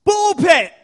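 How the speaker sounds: high, explosive group-style yell; sudden shout. Acted. Whole room shouting at the man in the chair, sudden yell. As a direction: bellow it in one loud, high burst. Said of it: "everyone around the edge of the room looks up and shouts".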